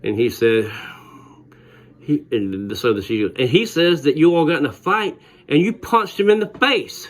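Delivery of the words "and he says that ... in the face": higher, lightly put-on teacher voice; mock teacher. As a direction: imitate the stern teacher, half in jest. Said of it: "she's not talking like this".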